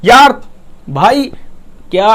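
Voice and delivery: mock-irritated यार भाई क्या; tone demo. Shown as a demonstration. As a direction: show an exasperated tone. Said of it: "बहुत से tone में जैसे बोल सकते हैं".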